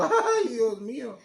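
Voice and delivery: high, loud, drawn-out mock wail; horrified husband. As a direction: wail it in comic dismay at your bride. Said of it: "dices tú".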